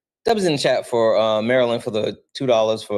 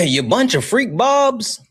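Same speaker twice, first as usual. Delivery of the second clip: high mock impression, repeated louder; imitating Solid; 12 semitones higher, 3 dB louder than usual. Streamer repeats friend's catchphrase for chat, high mock voice. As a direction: pitch it way up like the friend. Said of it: "That's, that's how he, he says it".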